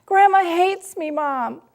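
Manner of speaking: high, loud, trembling plea on the verge of tears; a hurt teen choking back tears; imitating a distraught nineteen-year-old; tearful upset daughter. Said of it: "her lip is quivering and her eyes are filled with tears".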